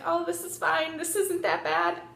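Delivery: lightly high-pitched self-reassurance. Retold or imitated. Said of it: "So in my head, I was like".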